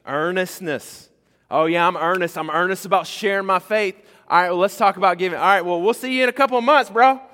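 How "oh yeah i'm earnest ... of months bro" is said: high, loud, fast brush-off; hammed-up breezy excuse, much higher and louder; mock dismissive churchgoer. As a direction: rattle it off like a guy ducking the offering talk. Mocking a congregant dodging giving.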